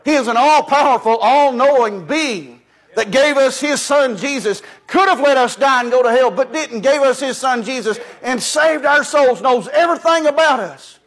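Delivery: soaring sermon delivery lifting each phrase; full-force preaching. Acted. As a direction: preach it out to the congregation. Preacher exalting God.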